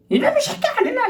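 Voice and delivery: God yelling to wake the lazy believer, hammed-up and laughing; mock furious God. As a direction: bellow at the sleeper in playful rage. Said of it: "이러면 당장 일날 건데".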